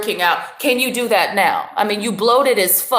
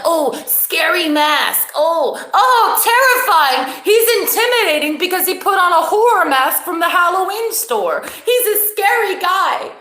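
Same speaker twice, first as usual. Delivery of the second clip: mock fear; 7 semitones higher than usual. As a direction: ham up fake fright at the mask.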